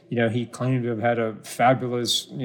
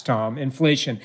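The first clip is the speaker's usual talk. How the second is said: ordinary talk, nothing marked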